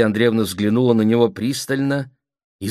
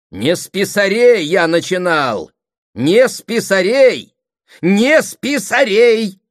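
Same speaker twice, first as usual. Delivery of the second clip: high, loud, slowing repeats; angry shouting; 12 semitones higher, 8 dB louder, slower than usual. A narrator's reading. Brother defending how his career began, angry shouting. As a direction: escalate into a drawn-out shout. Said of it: "Сергей Андреевич уже кричал".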